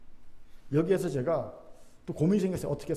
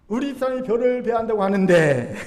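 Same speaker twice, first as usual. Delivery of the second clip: louder, higher hammed-up hint; indirect Chungcheong villager; 3 semitones higher, 7 dB louder than usual. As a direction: push the hint louder, swelling through the ending. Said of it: "충청도식 간접 화법". (크게 강조한 충청도식 간접 화법)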